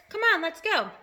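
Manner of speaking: rally everyone to set off; eager chorus. A narrator's reading. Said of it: "they chorused".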